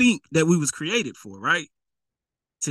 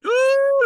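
high squealed ooh; a sudden high ooh at the pride he hears; surprised ooh; 4 dB louder than usual. Real, not acted. Preacher reacting to the rich man's pride with a high ooh. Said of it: "look at that pride talking".

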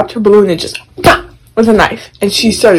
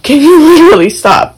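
higher, louder fed-up demand; exasperated snap; 8 semitones higher, 4 dB louder than usual. Retold or imitated. Gamer telling little sister to stop, exasperated. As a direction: demand she stop, annoyed. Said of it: "I was like".

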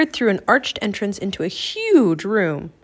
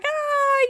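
very high-pitched scream; acted frightened yell. A narrator's reading. Annie joins the screaming, acted frightened yell. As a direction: let out a high cry of fright. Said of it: "yelled Annie".

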